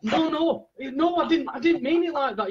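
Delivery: high-pitched mock-panicked protest; imitating a mate desperately explaining himself; flustered backtracking. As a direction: scramble to take it back, pitch shooting up. Acting mate's panicked backtracking.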